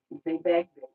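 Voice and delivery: ordinary talk, nothing marked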